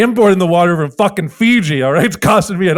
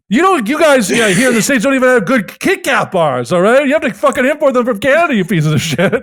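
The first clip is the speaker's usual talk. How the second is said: higher, pushy mock tirade; angry Wonka rant; 6 semitones higher than usual. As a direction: berate the kid about candy. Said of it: "getting madder and madder".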